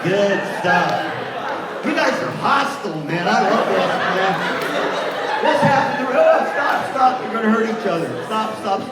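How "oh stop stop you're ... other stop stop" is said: loud, high call to halt the group; calling out stop. Real, not acted. Workshop leader shouting to stop rough partners. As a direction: cut them off before someone gets hurt.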